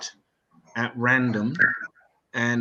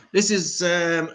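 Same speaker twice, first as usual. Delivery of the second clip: ordinary talk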